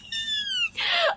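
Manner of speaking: very high-pitched delighted squeal; excited scream. Real, not acted. Fan thanking friend for a gift, squealing. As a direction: let out a thrilled squeal. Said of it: "I'm screaming".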